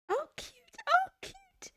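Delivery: high-pitched squeal of delight. Real, not acted. Watching the show, swooning high squeal at a cute guy.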